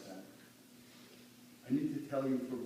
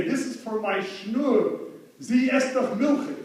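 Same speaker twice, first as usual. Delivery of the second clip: proud father-in-law; 9 semitones higher, 12 dB louder, slower than usual. Retold or imitated. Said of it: "and say".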